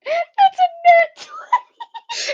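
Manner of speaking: high, slow, laughing squeal; thrilled, drawn-out high exclamation; excited disbelief. Real, not acted. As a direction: cry out in delighted amazement. Celebrating the lucky roll.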